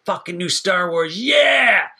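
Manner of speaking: building to a loud excited yell; excited fan cheer. Retold or imitated. Moviegoer cheering at the opening crawl, excited yell. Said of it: "You're like".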